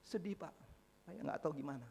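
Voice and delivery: softly voiced, mildly sad candidate; subdued mock sadness; imitated sad reply. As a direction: answer quietly, as if a little downcast. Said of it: "sedih nggak kamu?".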